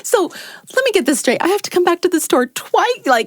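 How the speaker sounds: high, loud exasperated disbelief. Retold or imitated. Telling her friend about the doubled trip, exasperated high voice. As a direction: let the annoyance spill out high and loud. Annoyed retelling of the mishap.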